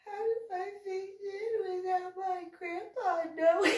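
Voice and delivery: put-on high, soft tearful kid's voice; crying boy. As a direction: whimper it like a scared boy.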